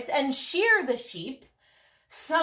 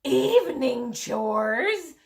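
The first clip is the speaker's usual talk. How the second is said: slow, higher, louder; dismayed cry; 4 semitones higher, 5 dB louder, slower than usual. A narrator's reading. Tired princesses hearing of more chores, dismayed cry. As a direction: cry out in disbelief. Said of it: "cried the princesses".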